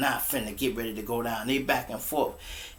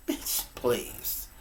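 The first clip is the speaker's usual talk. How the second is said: whispered dismissal; 5 dB quieter than usual. Retold or imitated.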